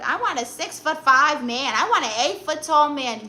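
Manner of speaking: exaggerated, insistent "I want" voice; mock demanding women. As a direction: imitate women making outrageous demands. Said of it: "There's women who are like".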